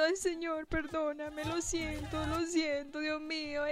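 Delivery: imitating crying. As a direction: replay the weepy apology, lightly acted. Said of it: "Y yo llorando".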